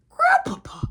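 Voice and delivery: very high, crying out his name; a stunned, pleading squeal; high, whiny, shocked cry; shocked wail. Retold or imitated. Shocked plea to grandpa.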